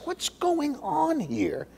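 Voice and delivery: high, slow, softened disbelief; baffled questioning. Acted. Peter questions Ananias for an answer, baffled and high. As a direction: stretch the question out high in bewilderment. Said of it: "is questioning him".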